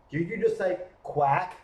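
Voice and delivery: ordinary delivery, nothing marked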